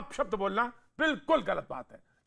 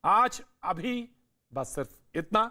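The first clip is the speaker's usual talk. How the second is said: lower, composed presenter read; news anchor sign-off; 5 semitones lower than usual. Real, not acted. Anchor gives viewers the day's question and signs off, composed and lower. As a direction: close the show in a measured anchor voice.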